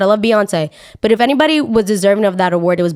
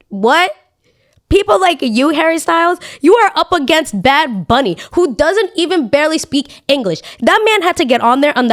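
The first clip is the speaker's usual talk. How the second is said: indignant outrage; 8 semitones higher than usual. Real, not acted. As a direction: go off at Harry, really fired up. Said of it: "I'm really angry right now".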